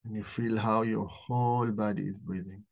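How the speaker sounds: calm guided breathing meditation. Acted. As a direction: ease them into the breath, gently.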